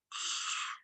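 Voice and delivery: soft voiceless "ah"; breathy heart sigh. Retold or imitated. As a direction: let out a whispered gasp of feeling. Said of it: "my heart just went".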